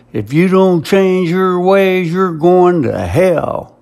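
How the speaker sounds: high, loud street-preacher yell, slowing at the end; retold preacher shout. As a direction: bellow it like a fire-and-brimstone preacher. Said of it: "pointed at me and shouted".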